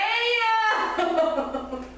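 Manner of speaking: squealing high laugh; big high laugh. Real, not acted. Scene: hosts cracking up at Jake's insult with a high laugh. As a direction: burst out cracking up.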